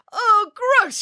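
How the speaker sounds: very high squeal of disgust; narrated scream, pitched far up. A narrator's reading. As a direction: squeal it like a revolted princess. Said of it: "she screamed less formally".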